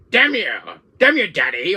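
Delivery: high, loud cartoon-villain outburst at Daddy; Wesker voice. Son yelling at his dad in Wesker's voice, loud. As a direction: yell it like an angry little Wesker. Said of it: "that Albert Wesker voice".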